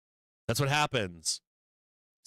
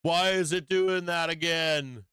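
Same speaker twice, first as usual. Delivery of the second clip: panicked trader; 5 semitones higher, slower than usual. Retold or imitated. Trader staring at new highs, mock disbelief. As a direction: wail it like a trader who missed out. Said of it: "look at the new highs and go".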